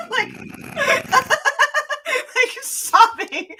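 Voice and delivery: high, laughing as she says it; giggling through the word. Real, not acted. Telling friends about crying, laughing. Laughing while saying sobbing.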